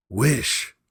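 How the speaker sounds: high, loud insistence; strong command. A narrator's reading. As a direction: repeat the order hard. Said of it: "repeated his wife".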